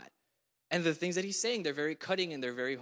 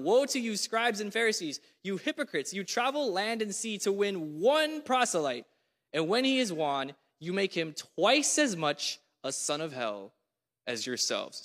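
raised, forceful reading of Jesus' rebuke; preaching scripture; 4 semitones higher than usual. Acted. Preacher reading Jesus' woes to the congregation, forceful. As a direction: proclaim each woe, leaning on it.

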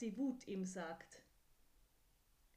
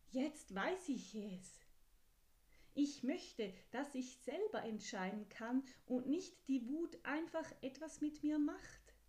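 higher and louder, eager and sure; determined little horse; 4 semitones higher, 3 dB louder than usual. Acted. Horse telling the kind voice what it wants, higher and louder. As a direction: announce the discovery proudly.

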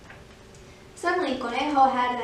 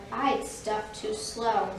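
very slow, subdued tortoise; tortoise character; 2 semitones lower, 3 dB quieter, slower than usual. Acted. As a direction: let every word drag like a tortoise. Tortoise's excuse, slow and low.

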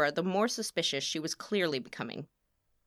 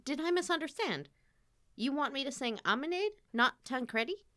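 surprised; 9 semitones higher than usual. Acted. Singer realizes unwanted role.

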